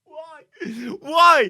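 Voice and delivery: high shouted anguished why; anguished cry. Real, not acted. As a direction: cry out in disbelief. Teammate walks into danger.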